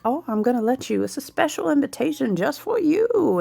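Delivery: high, sing-song crone offering a treat; hammed-up old witch invitation. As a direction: coax like a witch with a poisoned gift. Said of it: "And she's like".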